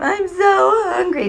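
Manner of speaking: put-on slow, high whine of a child; hammed-up drawn-out whining. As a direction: drag it out like a whiny kid. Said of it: "If they whine".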